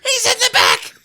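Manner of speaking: put-on high, loud old-lady shout through laughter; laughing mock old-woman yell; mimicking Arlene. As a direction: shriek it like a batty old neighbour. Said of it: "she tells him".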